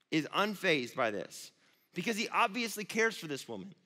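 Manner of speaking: ordinary talk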